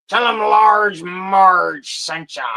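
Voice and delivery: slow, loud, spooky movie-ghoul voice; hammed-up eerie shout, very slow; Large Marge quote. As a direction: drag it out and boom it like a ghostly trucker. Quoting Pee-wee movie line.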